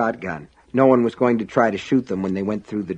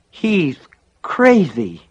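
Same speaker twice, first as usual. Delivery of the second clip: raised, mushy dazed voice; 6 semitones higher, 3 dB louder, much slower than usual. A narrator's reading. Injured John to his rescuers, mushy and dazed. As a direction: slur it as if stunned, through a busted mouth. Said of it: "John said in a mushy, dazed voice".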